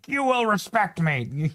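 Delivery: high, drawn-out imitation of someone demanding respect; mock a self-important demand, slowly and high-pitched. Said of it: "nobody ever gets respect by demanding".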